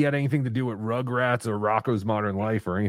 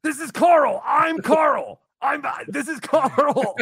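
much higher, goofy put-on voice; Carl impression; 12 semitones higher, slower than usual. Shown as a demonstration. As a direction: squeak his name like a cartoon. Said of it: "Is that your impression of him".

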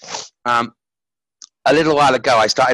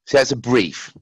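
usual speaking voice

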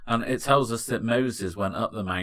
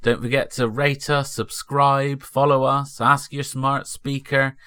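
podcast announcer; 3 semitones higher, 4 dB louder than usual. Acted. Podcast outro read.

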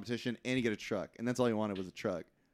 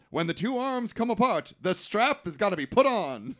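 high, loud ringside announcer; hammed-up sports announcer call; 8 semitones higher, 5 dB louder than usual. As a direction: proclaim the rule to the arena. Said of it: "And then the announcer says".

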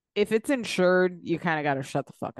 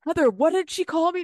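higher, louder indignant demand in a hammed-up voice; mock outraged drunk; 10 semitones higher, 4 dB louder than usual. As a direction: ham up a drunk woman demanding answers. Imitating Mary's drunken outrage.

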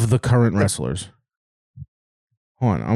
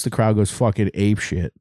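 usual speaking voice

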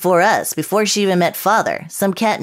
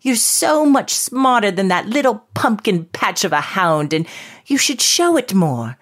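put-on English accent. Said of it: "I'm an English short-haired pointer".

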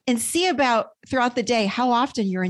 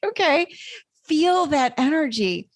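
much higher, laughing, mock triumphant okay; 7 semitones higher than usual. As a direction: crow over the win with a laugh. Coach triumphs, laughing, over client's fear.

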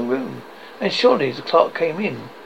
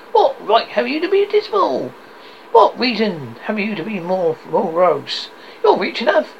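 high, lively teasing comeback; cheerful nephew's retort; 7 semitones higher, 4 dB louder than usual. Acted. Nephew turns Scrooge's words on him, cheerful retort. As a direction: throw the question back, pitched up.

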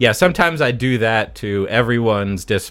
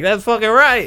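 high put-on voice; 10 semitones higher than usual. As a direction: hype voice backing the joke. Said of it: "they'll be like".

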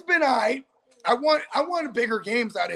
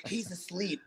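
very soft, hushed imitation; hushed phone excuse; 5 semitones lower, 15 dB quieter than usual. Woman covering for him on the phone, hushed. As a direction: drop right down, voicing the woman on the phone.

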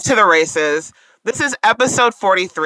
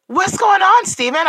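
much higher, bright greeting call; big hello; 11 semitones higher than usual. Real, not acted. Host greeting co-host on air, big bright hello. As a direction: sing out his name.

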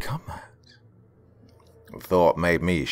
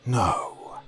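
firm, scolding no; stern reprimand; 6 semitones higher than usual. Acted. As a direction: cut her off sternly. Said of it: "he sternly reprimanded".

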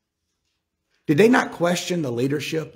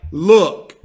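forceful order; 5 dB louder than usual. Acted. Preacher acts out Joab grabbing messenger.

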